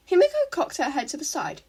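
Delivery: plain reading voice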